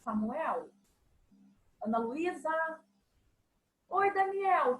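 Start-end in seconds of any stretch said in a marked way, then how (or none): 0.0-2.8 softer calling of the names; a mother calling her children softly. Shown as a demonstration. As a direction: say the names gently, close by. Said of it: "intensidade fraquinha".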